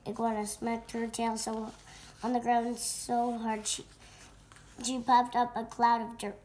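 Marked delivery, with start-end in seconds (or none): none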